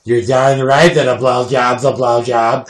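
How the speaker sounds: slow, loud drawn-out mock holler; hammed-up cowboy type. Host mocking people who'd agree with his guest, hammed-up cowboy voice. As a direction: crow it like a rowdy buckaroo.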